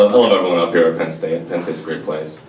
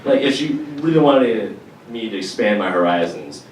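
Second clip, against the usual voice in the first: ordinary delivery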